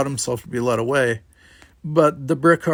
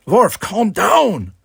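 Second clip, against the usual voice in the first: high, drawn-out shout; 9 semitones higher, 8 dB louder, much slower than usual. A narrator's reading. Simon tries to stop his brother, shouting. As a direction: shout slowly to rein someone in. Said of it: "shouted Simon".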